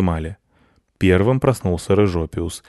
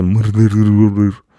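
muffled, slow, louder mumble through a mouthful of fish; mumbling with mouth full; 5 dB louder than usual. Acted. Proud cat mumbles with fish in his mouth, garbled. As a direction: chew the words into mush. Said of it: "рот рыбой занят".

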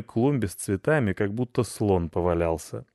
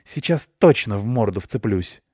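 louder, irate cat threat; angry threat; 2 semitones higher, 6 dB louder than usual. A narrator's reading. Cat provoked by the dog, angrily threatening. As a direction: threaten to pounce right now.

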